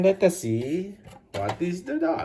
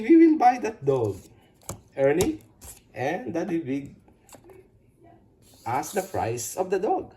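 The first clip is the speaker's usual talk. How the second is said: usual speaking voice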